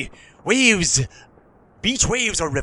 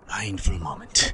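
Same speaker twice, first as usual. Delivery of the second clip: low, soft, hushed mock-serene announcement; mock meditation voice; 10 semitones lower, 5 dB quieter than usual. Captain humoring his crew, in a hushed mock-serene tone. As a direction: intone it like a sham guru.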